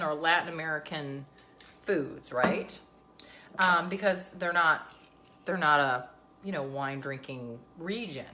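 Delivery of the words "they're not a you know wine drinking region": ordinary talk, nothing marked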